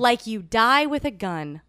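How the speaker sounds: "die" stressed, said slowly; slowly walk them through how the name sounds; slow, spelled-out pronunciation hint. Shown as a demonstration. Guest teaching her maiden name, slow and deliberate. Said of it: "what would we say?".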